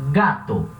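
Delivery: gato with a throaty g; gato, g from the base of the throat; Spanish g. Shown as a demonstration. Teacher showing a Spanish throat g. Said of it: "O G vem aqui na base da garganta".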